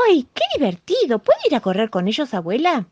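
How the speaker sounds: high, loud eager outburst; enthusiastic. Acted. Lamb asking grandma to run with the animals, enthusiastic. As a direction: burst out eager to run and play. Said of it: "El corderito entusiasmado dijo".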